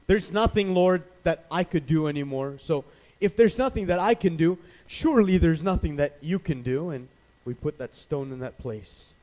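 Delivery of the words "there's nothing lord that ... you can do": loud, insistent complaint to God; emphatic plea of defeat; despairing protest. Acted. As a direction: argue hopelessly, voice raised. Acted believer protesting to God.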